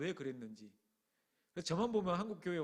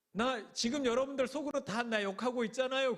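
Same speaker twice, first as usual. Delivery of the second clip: louder, higher accusing wail; resentful outburst; 7 semitones higher, 6 dB louder than usual. Retold or imitated. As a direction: fling the accusation at the group. 나이 든 권사님의 격한 원망. (서운함에 언성을 높여 따지는 말투)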